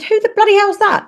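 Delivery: pitched high in mock disbelief; retold shocked outburst. Mother seeing a stranger's car, high mock disbelief. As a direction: fling the question up high, incredulous.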